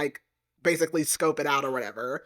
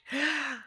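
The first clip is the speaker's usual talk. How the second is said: high, quiet gasp of shock; mock gasp; 8 semitones higher, 9 dB quieter than usual. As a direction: gasp as if suddenly caught, acted. Acts out the heroine's shocked reaction.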